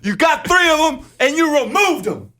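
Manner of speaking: imitating a dad at his wits' end; exasperated dad. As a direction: spell it out, losing patience. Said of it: "And he goes".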